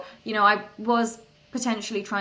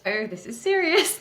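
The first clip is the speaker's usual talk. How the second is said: higher-pitched retold "oh" moment; dawning realization; 8 semitones higher than usual. Founder recalls researching the opportunity, dawning realization. Acts out her sudden realization.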